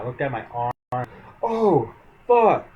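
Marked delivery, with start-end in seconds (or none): 1.4-2.7 a sudden high, loud curse of alarm; shocked curse. Retold or imitated. Seeing his injured arm the next morning, a high loud shocked curse. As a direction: blurt it out as the wound sinks in.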